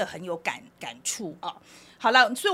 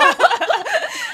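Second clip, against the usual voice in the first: loud high laugh; 11 dB louder than usual. Real, not acted. Laughing hard at her friend's story.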